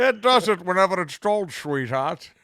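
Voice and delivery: mock Scottish brogue; Sean Connery. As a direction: ham up a Scottish star playing a creepy killer. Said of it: "He's in Silence of the Lambs".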